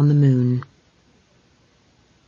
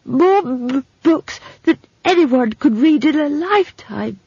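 high, halting and enraptured; stammering, in love; 10 semitones higher than usual. Acted. Simon marvelling at countless books, lovestruck stammer. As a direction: stutter through lovestruck wonder. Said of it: "in the voice of a man in love".

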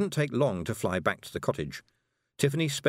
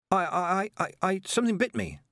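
high, stammering excuse; rattled, high-pitched fib; flustered lie; 10 semitones higher than usual. A narrator's reading. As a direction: stumble over the first word, then blurt it out. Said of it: "lied Tiffany".

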